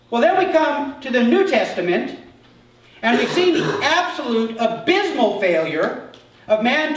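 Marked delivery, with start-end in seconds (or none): none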